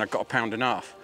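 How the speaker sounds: usual speaking voice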